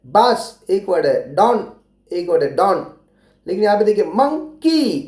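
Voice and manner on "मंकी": "मंकी" broken into मं and की with a short gap; split syllables. Shown as a demonstration. Teacher shows children the two syllables of "मंकी", split apart. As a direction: say "मंकी" as two separate pieces, मं, की. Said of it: "की एक word है".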